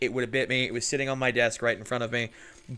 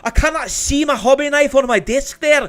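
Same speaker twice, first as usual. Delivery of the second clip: loud, high, angry mock Scottish rant; a hammed-up Scottish outburst about a lost hobby knife; Scottish accent; 11 semitones higher, 10 dB louder than usual. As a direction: rant like a frustrated Scotsman, much higher and louder. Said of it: "Not a great Scottish accent".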